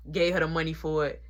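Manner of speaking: normal talking voice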